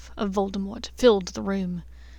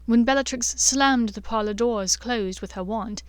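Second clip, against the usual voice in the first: usual speaking voice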